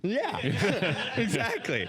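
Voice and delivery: high laughing yeah, exactly; laughing, high and bright yes; laughing agreement. Real, not acted. Laughing at host's joke.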